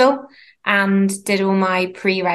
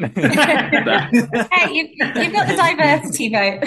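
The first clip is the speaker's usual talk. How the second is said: laughing while joking; words spilling through laughter; 5 semitones higher, much slower than usual. Real, not acted.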